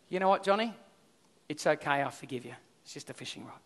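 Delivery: soft, low, fading reassurance; calmly forgive the boy; gentle forgiveness. Retold or imitated. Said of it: "this amazing moment of being like Jesus".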